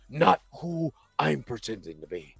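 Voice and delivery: low, hushed dramatic voice; soft, deep, hammed-up monologue; brooding character voice. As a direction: drop low and quiet, like a brooding antihero.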